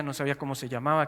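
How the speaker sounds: usual speaking voice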